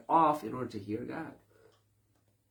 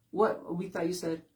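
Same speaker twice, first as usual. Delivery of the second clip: mock surprise; 9 semitones higher, 4 dB louder than usual. People react in surprise.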